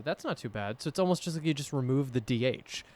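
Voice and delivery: ordinary talk, nothing marked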